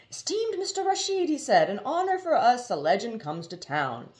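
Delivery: high, fawning voice of the slick gent; butter him up, pitched high; oily flattering gent. Acted. Slick gent greeting the famous storyteller, fawning and high.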